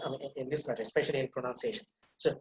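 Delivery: ordinary talk, nothing marked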